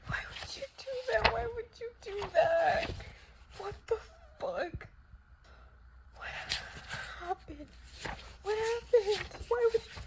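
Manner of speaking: very high, tearful wail; crying. Real, not acted. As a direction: plead in real distress through sobs. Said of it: "We can't see my tears".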